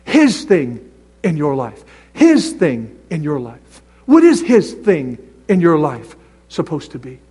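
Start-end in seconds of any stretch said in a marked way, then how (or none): 0.0-3.8 "His" leaned on with a falling tune, slowly; emphatic repeat. Shown as a demonstration. Preacher driving home God's will, slow with weight on "His". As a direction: press on "His" each time. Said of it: "repeated emphasis".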